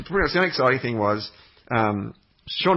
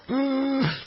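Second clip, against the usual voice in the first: mock gasp for air; 13 semitones higher than usual. Struggling up the hill on bike.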